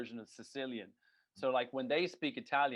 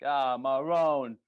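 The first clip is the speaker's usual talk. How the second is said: slow, louder Italian-American "Ah, Marone"; Italian-American exclamation; 9 dB louder, much slower than usual. Retold or imitated. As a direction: drag out "Ah, Marone" like a Sopranos mobster. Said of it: "one thing they always say is".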